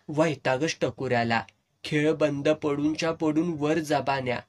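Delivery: ordinary delivery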